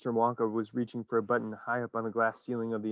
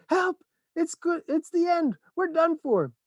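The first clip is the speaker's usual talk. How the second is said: very high, loud cries of doom; terrified shout; 4 dB louder, slower than usual. A narrator's reading. Grandpa Joe crashing through roof, terrified. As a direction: scream it in terror. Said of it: "Grandpa Joe shouted".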